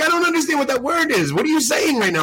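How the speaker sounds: very high-pitched, comically baffled retort; mock exasperation. Retelling his answer to the Scottish priest, exasperated. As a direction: act out being totally lost.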